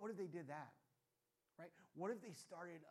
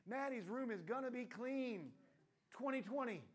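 higher, louder, slow rally chant; mock campaign slogan; 7 semitones higher, 8 dB louder than usual. Maddie's campaign slogan, hammed-up and loud. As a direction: sell it like a campaign.

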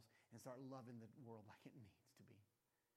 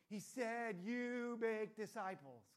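slow, higher, louder, hammering each word; blunt answer; 6 semitones higher, 8 dB louder, much slower than usual. Acted. Answering the study group, slow and emphatic. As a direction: spell it out flatly. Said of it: "What did Jesus mean".

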